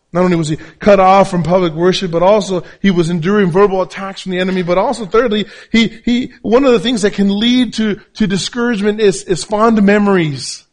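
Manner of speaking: high, loud, emphatic sermon delivery; full-force preaching. Real, not acted.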